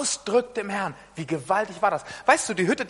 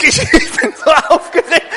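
higher and louder, laughing through the words; laughing, flustered; 7 semitones higher, 8 dB louder than usual. Retold or imitated. Husband telling his wife he is flustered, laughing. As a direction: blurt it out laughing at yourself. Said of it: "Ich sag".

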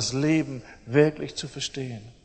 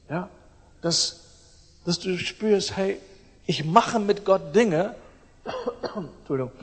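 usual speaking voice

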